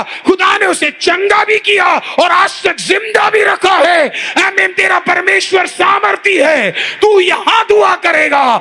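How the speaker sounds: high, loud, slow proclamation shouted to the church; preaching at full force. Acted. Pastor shouting God's power to the congregation, full-force preaching. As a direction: build to a roaring altar-call climax.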